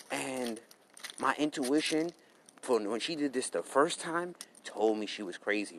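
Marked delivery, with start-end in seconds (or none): none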